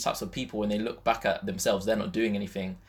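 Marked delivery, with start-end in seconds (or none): none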